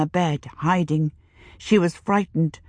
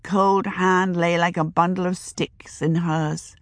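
usual speaking voice

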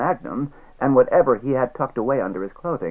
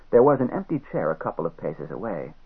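ordinary delivery, nothing marked

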